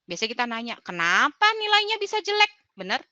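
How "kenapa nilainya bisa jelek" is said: high, loud, blaming "Kenapa"; a parent cornering a child with why; accusing question. Shown as a demonstration. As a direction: demo of the wrong way to ask, sharp and high. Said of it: "Oke, itu hindari".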